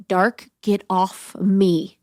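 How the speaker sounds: slow, drawn-out mock plea; retold suffocation. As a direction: push the dark away word by word, slowly. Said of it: "the darkness is suffocating".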